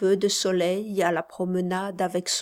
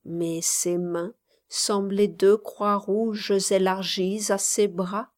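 ordinary delivery, nothing marked

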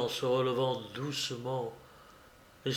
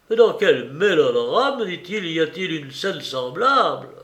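higher, louder, swooping exclamation; incredulous, emphatic question; exasperated outburst; 4 semitones higher, 6 dB louder than usual. A narrator's reading. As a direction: exclaim in mild exasperation at the theatrics. Husband scorns the scene as melodrama.

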